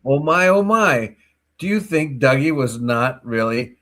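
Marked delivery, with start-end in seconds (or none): 0.1-1.2 mock surprise. Mock shock at news.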